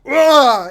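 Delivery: loud sudden pained yell; pained yelp. Retold or imitated. Flash yanks his hand from the stump, loud pained yelp. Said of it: "And he, he goes".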